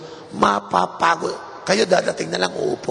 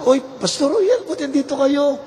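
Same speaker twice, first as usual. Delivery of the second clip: bright, much higher welcome to the pastor; eager usher greeting; 9 semitones higher than usual. Retold or imitated. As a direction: play the usher thrilled to see the guest. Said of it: "Nakita ko ng mga ushers". (masiglang bati ng usher, mas mataas ang boses)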